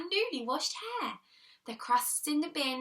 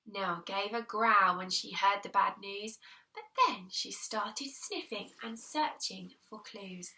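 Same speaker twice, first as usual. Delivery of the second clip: ordinary delivery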